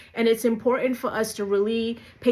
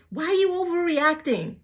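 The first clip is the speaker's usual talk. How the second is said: higher, scornful imitation; mocking dismissive quote; 7 semitones higher than usual. Imitating someone who dismisses her feelings, higher and scornful. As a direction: toss the accusation back in a higher voice.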